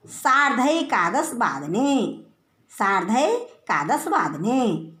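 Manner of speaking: सार्ध एकादश वादने spoken slowly, word by word, twice; slow, careful recitation. Shown as a demonstration. Teacher dictating eleven-thirty in Sanskrit to students, slowly. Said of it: "साढ़े ग्यारह बजे हैं तो".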